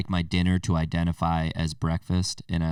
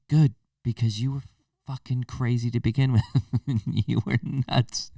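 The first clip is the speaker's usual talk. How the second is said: higher, put-on dismissive voice; mock relieved crowd; 6 semitones higher than usual. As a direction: sneer it like people glad to see them go. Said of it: "most of the people are like".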